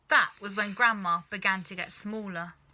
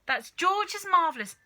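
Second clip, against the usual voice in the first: high and urgent; alarmed mother; 10 semitones higher, 6 dB louder than usual. Acted. Mother warning grandma about George's medicine, high and urgent. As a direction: warn her off quickly.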